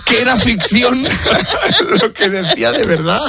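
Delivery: words squeezed out through real laughter; giggling high while finishing the thought; laughing. Real, not acted. Host telling co-hosts about the radio clip, laughing. Cracking up at own story.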